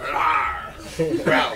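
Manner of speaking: loud, growling roar; imitated beast roar. Storyteller mimics a huge player bursting from the car, loud roar. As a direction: roar like King Kong bursting out.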